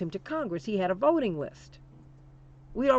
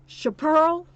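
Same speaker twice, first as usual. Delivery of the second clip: real emotion spilling over in a sudden cry; a pained, loud, high-pitched call of the name; heartbroken; 8 semitones higher, 9 dB louder, slower than usual. Real, not acted. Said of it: "that breaks my heart right now to say that".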